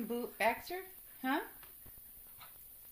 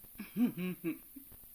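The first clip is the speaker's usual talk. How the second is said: laugh; 3 semitones lower, 6 dB louder than usual. Real, not acted. Laughing at scared dogs.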